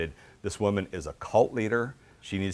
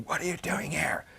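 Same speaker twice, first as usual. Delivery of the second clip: retold screaming; 6 semitones higher, 3 dB quieter than usual. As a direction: imitate the teacher yelling, pitched well up. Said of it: "she just starts screaming at me".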